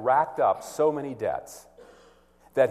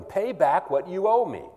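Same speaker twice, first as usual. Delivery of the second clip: higher, forceful demand for the debt; 7 semitones higher, 4 dB louder than usual. A narrator's reading. Servant choking his fellow servant, a harsh demand. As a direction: snap it out as a threat. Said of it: "he demanded".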